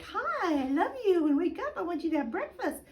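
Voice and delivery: high, bright and playful greeting; cheerful baby talk. Shown as a demonstration. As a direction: make waking up fun for the baby. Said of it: "make it fun".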